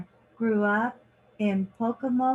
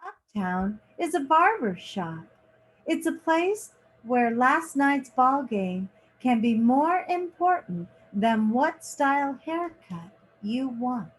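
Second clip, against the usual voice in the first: lively picture-book reading, lingering on each page; reading to children; 2 semitones higher than usual. A narrator's reading. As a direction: read it like story time for kids. Reads picture book aloud.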